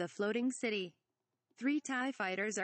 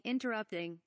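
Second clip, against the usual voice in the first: usual speaking voice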